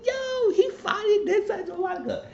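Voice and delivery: high, laughing squeal of delight; gleeful, laughing retelling; happy. Retold or imitated. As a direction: crack up while cheering him on. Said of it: "I was happy".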